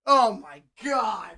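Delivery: ordinary talk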